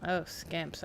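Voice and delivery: usual speaking voice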